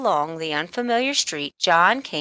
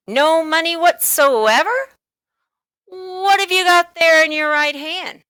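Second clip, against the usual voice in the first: sly storekeeper; 9 semitones higher, 6 dB louder than usual. A narrator's reading. Storekeeper eyeing the coin in John's hand, sly and playful. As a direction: tease the boy knowingly.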